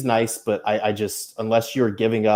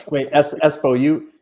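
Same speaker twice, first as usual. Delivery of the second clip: ordinary talk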